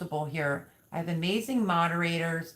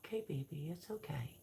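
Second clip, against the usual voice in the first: whispered, hushed reassurance to a chick; whispered soothing; 15 dB quieter, faster than usual. Real, not acted. Calming chick back in box.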